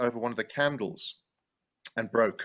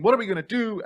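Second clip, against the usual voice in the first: panicked; 8 semitones higher, 5 dB louder than usual. Retold or imitated. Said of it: "everybody in the wings was panicking".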